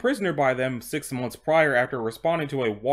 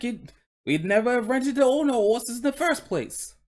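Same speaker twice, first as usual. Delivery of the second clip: higher, complaining Cockney horse; Cockney coach horse; 6 semitones higher than usual. Acted. Horse on hard times and rent, Cockney accent. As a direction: grumble along in put-on Cockney. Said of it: "he's speaking in a Cockney accent".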